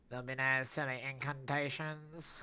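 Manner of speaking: a hint of a stern wizard forbidding spells; mildly acted stern character line; character voice attempt. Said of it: "Terrible, terrible, terrible, attempt there".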